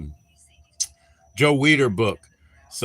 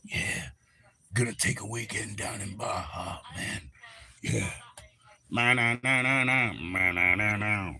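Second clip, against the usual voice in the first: mock drunk Dylan; 3 semitones lower, 8 dB quieter than usual. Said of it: "and he'd be like".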